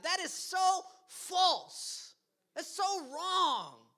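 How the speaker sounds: loud, slow, high preaching; emphatic rebuttal. Real, not acted. Pastor refuting his congregation, loud slow insistence. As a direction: hammer each word home.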